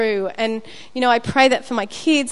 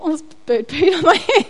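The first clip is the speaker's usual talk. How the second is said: high, loud laughing quote; laughing at the bird-poo idea; 6 semitones higher, 4 dB louder, faster than usual. Retold or imitated. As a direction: giggle through the thought. Funny memory of the drop.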